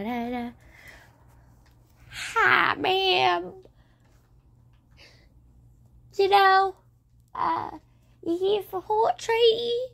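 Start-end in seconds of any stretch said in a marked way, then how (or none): 2.1-9.9 higher, put-on old lady voice; old woman. Horse trader greeting a customer in a higher old woman voice. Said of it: "I get forced to pretend like an old woman".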